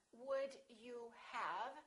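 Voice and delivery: "Would you have?" said slowly, rising; slow question. Shown as a demonstration. Teacher slowly models the full question. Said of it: "When this is a question".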